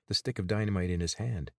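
usual speaking voice

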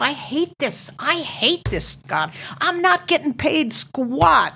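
half-hearted echo of bawling at the heavens; mild mock yell. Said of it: "I'm just shouting".

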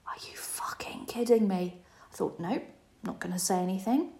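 under-the-breath, soft inner reaction; softly swallowed retort; hushed disbelief. Retold or imitated. Unspoken reaction to mum's jibe.

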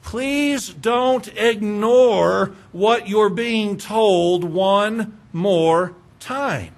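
plead, dragging each word; a drawn-out final appeal; very slow plea. Acted. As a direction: stretch every word out, very slowly. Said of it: "could be said like this".

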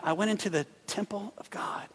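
hushed whisper. Acted. Pastor voicing David entering the temple, whispered. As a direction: breathe it like entering a holy place.

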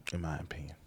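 whispered aside, soft and breathless. Real, not acted. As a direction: drop to a sly whisper.

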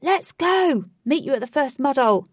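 high, loud, quick rallying call; shout. A narrator's reading. Ajax calling to friends as he passes, high hurried shout. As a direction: yell it in passing. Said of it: "Ajax shouted".

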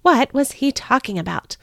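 ordinary delivery

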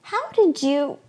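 high-pitched, breaking off in amazement; astonished exclamation. A narrator's reading. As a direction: exclaim it in wonder, leaving it unfinished. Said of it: "I exclaimed".

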